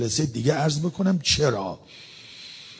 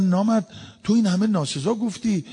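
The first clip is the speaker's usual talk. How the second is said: higher-pitched indignant challenge; acted-out rebuke, pitched up; retold reproach; 7 semitones higher than usual. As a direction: confront him in a raised pitch. People rebuke a weeping insulter.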